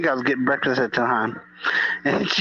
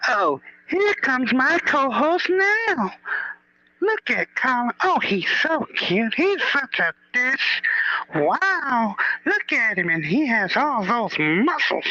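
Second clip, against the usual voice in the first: high, slow gushing admirer; mock swooning fan; 9 semitones higher, slower than usual. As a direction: swoon over the co-host in a high, dreamy voice. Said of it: "I'm just messing with him".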